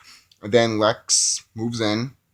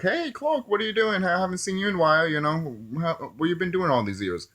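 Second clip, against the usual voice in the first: much higher, chirpy greeting voice; mock coworkers; 7 semitones higher than usual. Coworkers greeting Clark on his return, high chirpy mock voice. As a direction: greet him like a bubbly coworker. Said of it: "Everyone's just like".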